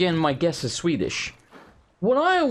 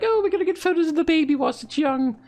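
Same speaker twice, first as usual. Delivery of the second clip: light high mock-sweet parent voice; lightly voiced gushing parent; 13 semitones higher than usual. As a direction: lightly play the doting new parents. Mimicking couple at photo shop.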